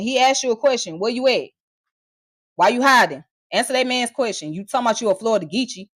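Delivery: ordinary delivery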